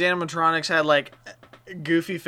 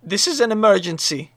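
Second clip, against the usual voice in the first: urgent; 5 semitones higher, 7 dB louder than usual. Acted. Kid demands items as emergency.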